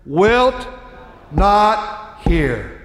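high, loud, slow anguished shout; thundering preacher crying out to a silent God; preaching at full force. Acted. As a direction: pour out the prophet's desperate plea. Finishing the verse.

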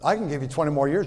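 ordinary talk

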